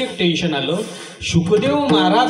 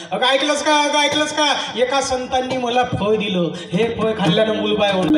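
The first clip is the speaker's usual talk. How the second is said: excited shouting; 7 semitones higher than usual. Acted. Husband shouts the news to his wife, high and excited. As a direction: shout the news through the house. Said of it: "एवढ्या जोरानं ओरडत होते".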